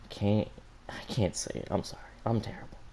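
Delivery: breathy whispered confession; scared whisper. Acted. Yang cornered by her sister, whispering her shame. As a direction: barely voice it, ashamed.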